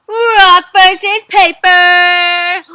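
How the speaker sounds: very slow, loud drawn-out announcement; fight announcer. Acted. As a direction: boom it out like a ring announcer. Announcing the matchup.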